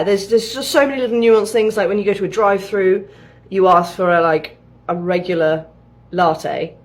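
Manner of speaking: usual speaking voice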